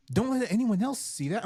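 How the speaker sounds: high, urgent scolding voice; mock scolding. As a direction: play the nervous coworker hushing him. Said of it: "they're like".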